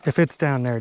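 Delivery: ordinary delivery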